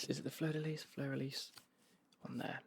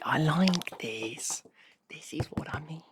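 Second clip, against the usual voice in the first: slow, loud delighted whisper; excited whisper; 3 semitones higher, 11 dB louder, slower than usual. Real, not acted. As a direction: whisper it with real joy. Delight at the note.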